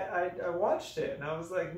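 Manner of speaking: ordinary talk, nothing marked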